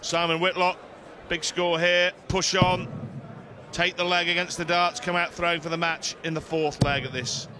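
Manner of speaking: ordinary delivery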